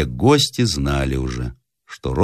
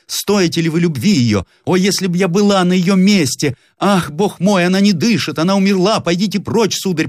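hysterical mother; 12 semitones higher than usual. Acted. Mother's hysterical outburst over daughter.

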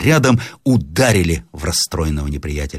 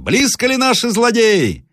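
high-pitched shouted question; крик сержанта. A narrator's reading. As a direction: call it up to a lookout. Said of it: "закричал наконец сержант". (громкий окрик вверх)

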